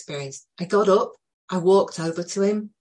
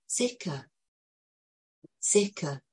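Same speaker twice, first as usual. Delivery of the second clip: soothing reassurance; 5 dB quieter, slower than usual. Retold or imitated. Soothing a distressed child.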